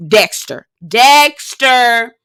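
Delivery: mock horror announcement; spooky dramatic call. Real, not acted. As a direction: ring it out like a horror reveal. Naming the creepy Dexter vibe.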